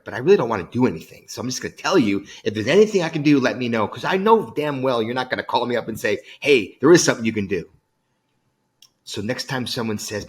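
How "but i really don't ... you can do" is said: loud, emphatic imitation of insincere helper; mock blunt admission. Speaker exposes what you really are saying, loud mock candor. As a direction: push it out louder, higher on the stock offer. Voicing the offer's blunt real meaning.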